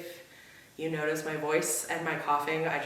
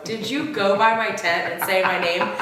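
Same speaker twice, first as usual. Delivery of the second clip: louder accusing question; mock accusation; 3 semitones higher, 8 dB louder than usual. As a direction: confront him with mock outrage. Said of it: "when I go".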